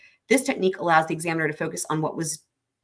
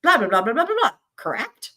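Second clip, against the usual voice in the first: louder blah blah with pitch leaping high, then a rising Correct?; mocking a lawyer; 7 semitones higher, 4 dB louder than usual. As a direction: mimic a lawyer's droning statement capped with a tag question. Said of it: "They're like".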